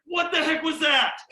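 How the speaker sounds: loud, high-pitched disbelief; startled outburst. Retold or imitated. Retelling John's reaction to the fleeing car, startled outburst. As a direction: blurt it out, startled. Startled friend in retold story.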